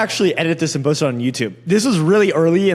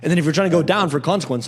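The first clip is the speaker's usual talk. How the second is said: ordinary talk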